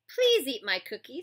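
loud sarcastic plea; mock pleading. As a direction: beg with a sneer. Zigzag mocking plea.

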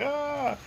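high, quiet "ah"; soft mock yell. Man continuing to yell under the message, soft high mock yell. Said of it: "I'm continuing yelling".

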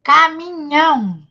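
CAMINHÃO slow, each syllable drawn out. Shown as a demonstration. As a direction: sound out "Caminhão" slowly as ca-mi-nhão. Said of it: "eu vou falar nas sílabas". ("Caminhão" pausado, em sílabas)

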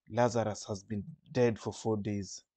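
ordinary talk, nothing marked

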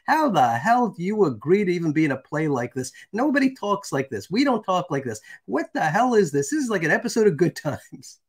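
high-pitched indignant scolding; mock outraged dressing-down; retold telling-off. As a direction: act out a woman laying into someone. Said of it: "She went off on him".

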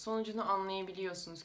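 normal talking voice

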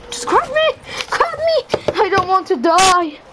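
very high-pitched squealed plea; mock panic. Player begs teammate to grab him, mock panic. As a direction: squeal for rescue in playful panic.

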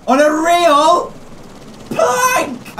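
thrilled yell, pitch cracking up; excited shout. Real, not acted. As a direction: voice leaps high on plank. Cry-shout on the plank.

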